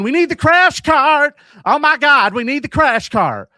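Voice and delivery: high, loud hammed-up alarm; mock panicked shout. Nurse runs out calling for help, hammed-up panicked shouting. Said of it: "then comes running out going".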